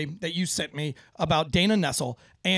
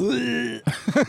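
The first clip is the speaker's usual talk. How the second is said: laugh; 6 semitones higher, 4 dB louder than usual. Real, not acted. Laughs at own joke.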